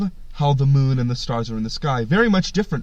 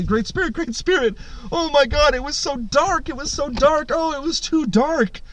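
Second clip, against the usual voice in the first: very high, hammed-up panicky whining; frantic pleading; 13 semitones higher than usual. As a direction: complain like a crowd of scared little animals. Frantic animals complain to Great Spirit.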